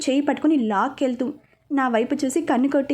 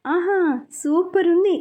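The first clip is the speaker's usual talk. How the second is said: slow, higher, dreamy ahh of pleasure; savouring every word, slowly; blissful sigh; 5 semitones higher, slower than usual. Acted. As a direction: melt into the massage. Enjoying the massage.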